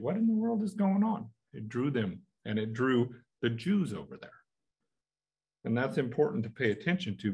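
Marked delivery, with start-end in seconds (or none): 0.0-1.3 high, startled what in the world; bewildered question. Retold or imitated. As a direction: pitch up in sudden astonishment. Imagined listener investigating a sound.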